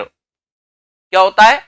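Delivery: ordinary talk, nothing marked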